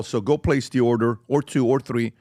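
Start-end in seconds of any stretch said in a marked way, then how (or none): none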